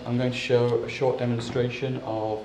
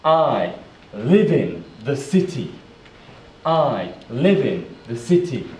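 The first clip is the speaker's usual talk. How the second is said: "I live in the city" in separate, louder chunks; careful model sentence; 5 dB louder than usual. Shown as a demonstration. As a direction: pause between "I", "live in" and "the city". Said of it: "Let's put it together".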